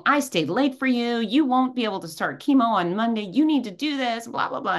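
mock a fed-up technician telling you off; retold yelling. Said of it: "she's yelling at me now".

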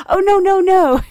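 a loud, high-pitched no no no; emphatic correction. Retold or imitated. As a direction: insist loudly that he misheard. Correcting the misunderstanding.

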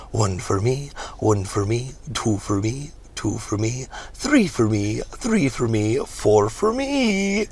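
singsong count, each phrase rising; gleeful miserly tally; greedy reverend counting. Acted.

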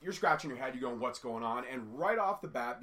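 ordinary talk, nothing marked

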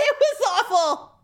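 real laughter, pitched high; laughing dismay. Real, not acted. Woman recalling her humiliation to friend, laughing dismay.

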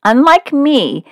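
usual speaking voice

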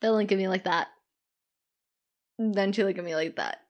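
ordinary delivery

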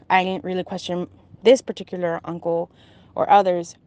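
ordinary talk

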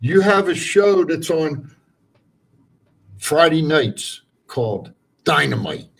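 rant show host. Acted. Continues the rant.

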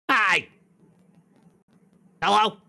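high, imperfect comedian impression; Gilbert Gottfried. Stone's voice greeting whoever attunes to it, imitating Gottfried. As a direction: pitch up the stone's cartoonish greeting. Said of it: "sounds like Gilbert Godfrey".

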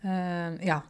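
ordinary talk

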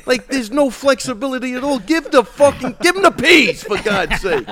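high, loud, disbelieving rant; exasperated yelling. Retold or imitated. Retelling a Boston Market line holdup, yelling. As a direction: yell it like the line won't move. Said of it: "I'm like".

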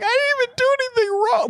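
tiny, extremely high squeal of protest; mock pleading. Imitating the other girl who walks in, mock pleading. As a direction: squeak it out like a hammed-up victim. Voices girl caught by the killer.

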